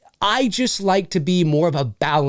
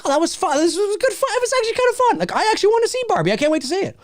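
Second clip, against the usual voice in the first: higher, climbing, rushed excitement; mock giddy fan; 12 semitones higher, much faster than usual. Playing a man who liked Barbie, high giddy gushing. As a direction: gush like an eager fan.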